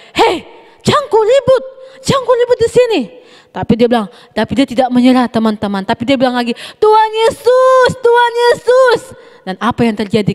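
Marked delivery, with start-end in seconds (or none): 0.1-3.0 scolding disciples. Retold or imitated. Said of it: "Langsung murid-muridnya bilang". 6.8-9.0 high, slow, loud calling; yelling for Jesus. Retold or imitated. Blind beggar yells for Jesus over the crowd, high slow shout. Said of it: "Karena dia berteriak dengan sungguh-sungguh".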